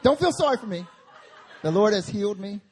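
high, loud, laughing mock piety; loud defiance. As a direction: proclaim your healing to the mockers. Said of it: "And I was like".

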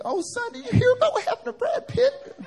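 high, put-on gossipy mom voice; mom impression. Mom gushing to her son about celebrity news, high mock-mom voice. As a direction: imitate mom in an exaggerated high pitch. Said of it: "my mom used to read 'em".

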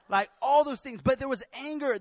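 ordinary delivery

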